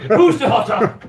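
crowing triumph through laughter; gloating through laughs after winning. Real, not acted. Gloating after winning hotness test.

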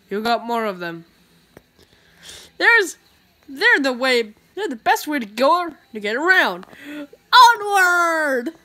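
usual speaking voice

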